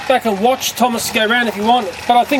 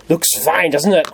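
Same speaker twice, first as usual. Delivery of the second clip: normal talking voice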